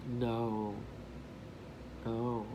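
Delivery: low, soft, slow-spaced denial; grave. Retold or imitated. Man correcting a tourist about English, grave. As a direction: quietly rule it out, leaving no doubt. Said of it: "he said it in such a way".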